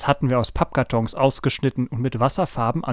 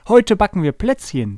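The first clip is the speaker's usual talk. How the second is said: higher, louder storybook mother; lifted, lively character line; cheerful mother announcing; 7 semitones higher, 5 dB louder than usual. A narrator's reading. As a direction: announce it brightly to the family. Mother announces baking at breakfast.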